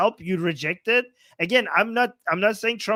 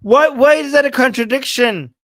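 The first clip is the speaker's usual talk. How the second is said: louder, incredulous questioning; loud indignant challenge; 3 semitones higher, 9 dB louder than usual. Real, not acted. Streamer objects to the anchor's question, loud and indignant. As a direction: challenge it loudly, in real disbelief. Reacting indignantly to news clip.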